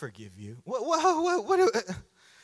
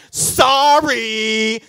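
loud, drawn-out grudging sorry; a kid made to apologize, dragging it out; sullen forced sorry; 3 semitones higher, 10 dB louder than usual. Retold or imitated. Kid told to apologize, loud and grudging. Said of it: "Not like you tell a kid".

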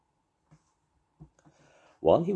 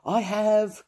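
high, loud eager cry; excited cry; 5 dB louder than usual. A narrator's reading. Paddington answering the judge, high excited cry. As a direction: burst out with it, thrilled. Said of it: "cried Paddington".

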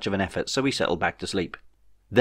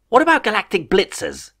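much higher, eager question; hopeful eager ruler; 11 semitones higher, 4 dB louder than usual. A narrator's reading. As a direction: ask brightly, grasping at hope.

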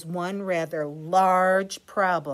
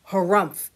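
grumpy harumph; 6 dB louder than usual. Acted. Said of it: "said Walrus".

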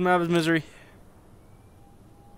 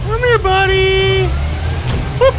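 taunting call; 13 semitones higher, 6 dB louder than usual. Real, not acted. Taunting enemy in game.

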